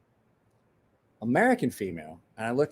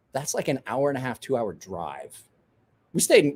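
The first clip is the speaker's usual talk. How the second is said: normal talking voice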